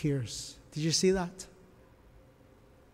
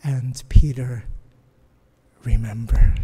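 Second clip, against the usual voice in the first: whisper; 5 semitones lower, 9 dB louder than usual. Acted. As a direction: draw the moment out in a hush. Dramatic retelling, Peter remembers.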